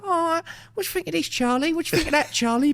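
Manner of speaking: high-pitched mocking taunt to his mate. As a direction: needle Charlie in a high mock voice. Mocking Charlie with a quote.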